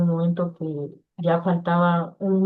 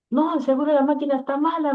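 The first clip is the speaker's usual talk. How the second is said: high, raised doubting voice of the doctor brother; brother's brisk dismissal; 8 semitones higher, faster than usual. Retold or imitated. Doctor brother dismissing the clean scan, pitched high. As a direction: toss it off as if the machine is broken.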